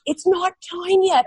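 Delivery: lightly voiced MC; imitating the MC. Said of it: "he's like".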